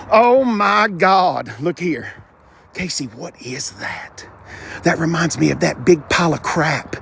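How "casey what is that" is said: slow unvoiced whisper. Real, not acted. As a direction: lean in and breathe the question slowly. Looking at the engine bay.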